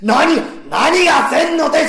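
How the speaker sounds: high, loud repeated "何が"; shouting husband. Acted. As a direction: blast it in his face. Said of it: "いきなり大きな声出してるんです". (夫婦喧嘩の稽古で、高く大声で怒鳴る)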